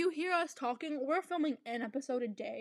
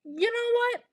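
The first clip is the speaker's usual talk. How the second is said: excited; 10 semitones higher, 5 dB louder, slower than usual. Retold or imitated. Retelling her own past decision.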